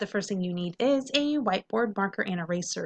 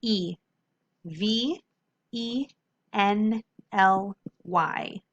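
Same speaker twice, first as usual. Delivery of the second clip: slow spelling; much slower than usual. Shown as a demonstration. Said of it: "next word".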